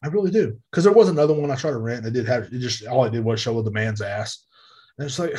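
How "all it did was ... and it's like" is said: ordinary talk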